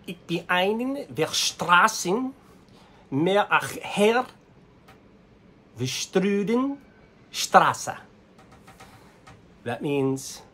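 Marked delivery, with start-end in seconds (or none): 0.1-8.0 high, loud, slow German greeting; attempted German. Shown as a demonstration. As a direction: try out German, pitched up and halting.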